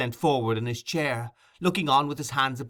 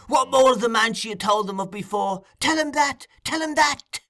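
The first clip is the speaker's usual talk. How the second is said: high demand climbing to a shriek; urgent cry; 11 semitones higher, 4 dB louder than usual. A narrator's reading. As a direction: build to frantic repeated orders.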